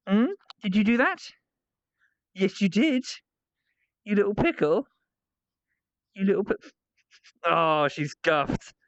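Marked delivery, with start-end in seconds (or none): 0.1-6.6 high teasing coo to a dog; lilting mock telling-off; playful pet scolding. Real, not acted. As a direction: tease a naughty pet, pitched up.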